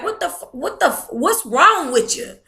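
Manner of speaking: real exasperation, what is wrong with you; incredulous outburst. Real, not acted. Venting about her mother's gossip.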